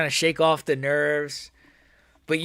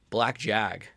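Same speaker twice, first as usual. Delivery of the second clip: flat dismissive; 5 semitones lower, 6 dB quieter than usual. Real, not acted. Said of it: "What does that even mean".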